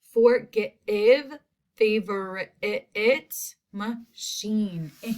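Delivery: "Forgive", "favorite", "machine" each split into syllables; oddball vowels sounded out. Shown as a demonstration. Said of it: "It just doesn't work".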